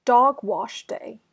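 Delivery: usual speaking voice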